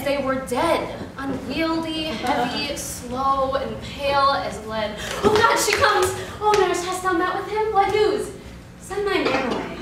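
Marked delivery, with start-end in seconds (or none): 5.2-8.3 eager burst, pitch lifted; breathless anticipation for news; excited. Acted. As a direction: pounce on the long-awaited messenger. Juliet sees awaited nurse return.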